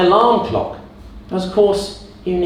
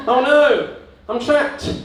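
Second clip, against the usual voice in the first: higher hammed-up alarm; miming in the box, hammed-up higher panic; mock trapped panic; 9 semitones higher, slower than usual.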